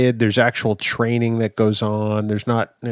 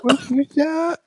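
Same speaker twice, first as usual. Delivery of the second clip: silly squeaky voice. Pretend. Silly voice answering the greeting.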